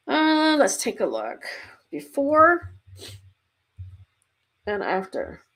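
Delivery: fighting off a sneeze; holding back a sneeze. Real, not acted. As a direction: hold in a sneeze while talking. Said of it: "Sorry, I'm trying not to sneeze".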